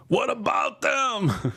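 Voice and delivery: high, slow mock shout from the back; heckler shout. Heckler in the back yelling at the comic, mock shout. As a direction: yell it across the club. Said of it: "some guy in the back goes".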